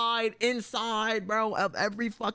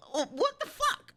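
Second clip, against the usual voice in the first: incredulous outburst. Retold or imitated. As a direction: pitch jumps way up in disbelief. Second dentist hurts him again.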